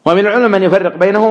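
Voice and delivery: ordinary talk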